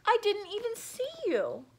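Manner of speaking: high hippo apology. Acted. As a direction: say it like a clumsy hippo. Hippo character voice.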